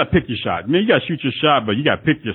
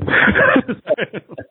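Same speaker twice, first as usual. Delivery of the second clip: high laugh; 11 semitones higher than usual. Real, not acted. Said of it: "laugh after joke".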